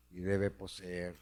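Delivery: ordinary talk, nothing marked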